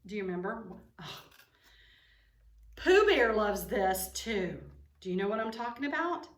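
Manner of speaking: usual speaking voice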